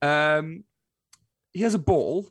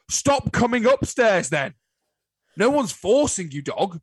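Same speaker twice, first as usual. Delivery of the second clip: louder, higher, slow telling-off; exasperated scolding; 6 semitones higher, 5 dB louder, slower than usual. Retold or imitated. Owner telling off the wailing puppy, loud and slow. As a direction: scold the dog with mock exasperation.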